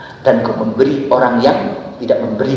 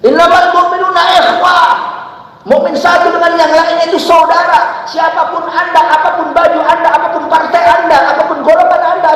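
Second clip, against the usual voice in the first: high, loud fiery preaching; preaching at full force; 4 dB louder than usual. Acted. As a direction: call it out to the whole congregation. (khotbah berapi-api dengan suara lantang)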